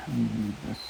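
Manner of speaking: muffled behind a gas mask; talking through a full gas mask. Real, not acted. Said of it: "Probably can't hear me".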